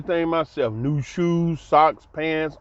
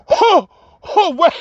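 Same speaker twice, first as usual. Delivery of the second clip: a high, loud gasp of surprise; shocked gasp; 12 semitones higher, 4 dB louder, slower than usual. Retold or imitated. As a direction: gasp as if you can't believe it. Overwhelmed by the money.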